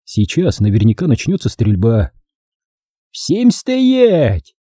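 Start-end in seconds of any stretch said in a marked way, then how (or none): 3.1-4.6 very high-pitched commanding shout; рявкнуть. A narrator's reading. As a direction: order everyone to freeze. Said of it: "рявкнул Аюб". (рявкнуть высоким голосом)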